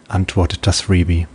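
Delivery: ordinary delivery, nothing marked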